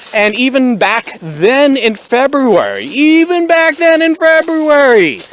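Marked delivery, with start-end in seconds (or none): 2.9-5.2 higher and louder, slow and stretched; emphatic repeat. Acted. Stressing the date to viewers, slow loud emphatic. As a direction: lean hard into it the second time. Repeats phrase, second more forceful.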